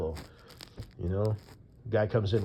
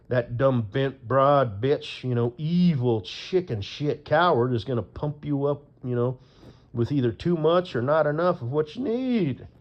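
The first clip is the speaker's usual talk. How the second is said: furious rant; 4 semitones higher, 5 dB louder than usual. Real, not acted. Furious rant about the woman.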